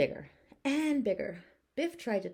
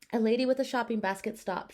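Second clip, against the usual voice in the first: plain reading voice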